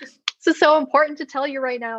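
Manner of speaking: high, urgent mock pleading; retold exasperation. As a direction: act out impatient excitement at an unanswered phone. Retold exasperation at unanswered call.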